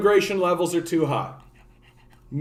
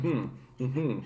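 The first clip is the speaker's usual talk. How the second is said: mock absent-minded mm-hmm; 2 semitones lower, 10 dB quieter than usual. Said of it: "and I'm like".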